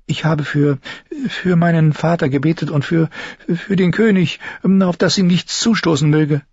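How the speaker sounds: high, halting and nervous with stalls; a scared girl groping for an excuse; frightened girl. Acted. Sansa lügt verängstigt. (ängstliches Mädchen, hoch und stockend)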